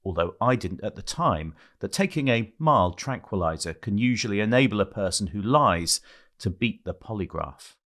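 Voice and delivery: measured reading voice, low and even; memoir reading. Acted. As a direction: read it like an audiobook passage. Reads memoir aloud over music.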